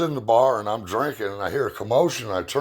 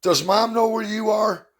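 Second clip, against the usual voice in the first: high, girlish imitation of his daughter; mock high teen girl voice; daughter's voice; 13 semitones higher than usual. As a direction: pitch it up like a young daughter on the phone. Daughter voiced in phone story.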